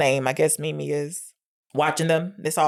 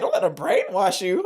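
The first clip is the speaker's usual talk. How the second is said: mock-incredulous repeat, rising at the end; retold disbelieving echo; 2 semitones higher than usual. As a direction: echo it back as an offended question. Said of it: "And Dean is like".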